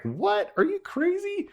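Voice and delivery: high-pitched mock disbelief; incredulous. Dad reacting to kids' duck plea, incredulous high yelp. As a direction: squeak it out as an astonished dad. Dad retelling shocked reply to kids.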